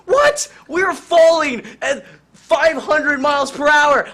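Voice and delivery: husband freaking out at his wife in free fall, screaming; shrieking panic. Acted. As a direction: scream it in hammed-up terror. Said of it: "And Jonesy's like".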